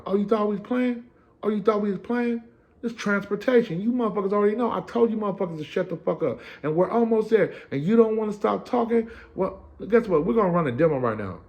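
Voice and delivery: high, barking drill-sergeant voice; mock sergeant. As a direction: play the mean sergeant scolding the bus. Sergeant yelling at inmates.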